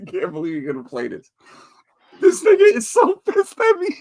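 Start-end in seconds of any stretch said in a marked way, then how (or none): 2.2-4.0 high, loud squeal of laughter; helpless laughter. Real, not acted. Watching his angry dog on video, cracking up.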